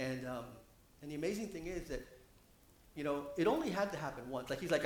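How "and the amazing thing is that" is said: normal talking voice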